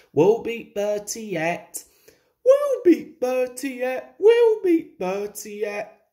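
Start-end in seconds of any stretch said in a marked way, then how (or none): 2.4-6.1 high, loud squeaky echo; higher, eager chorus; echoing carriages. A narrator's reading. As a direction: chant it back like little carriages. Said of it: "echoed Annie and Clarabel".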